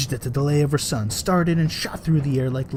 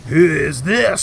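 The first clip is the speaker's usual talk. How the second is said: mild scream; 3 semitones higher, 7 dB louder, slower than usual. A narrator's reading. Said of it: "screamed Catelyn".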